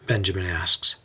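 usual speaking voice

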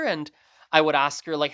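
ordinary talk, nothing marked

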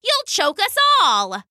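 high, sharp cheetah snap; high irritated cheetah voice; snapping cheetah. Acted. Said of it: "snapped the cheetah".

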